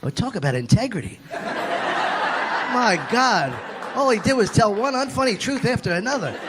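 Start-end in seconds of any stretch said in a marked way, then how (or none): none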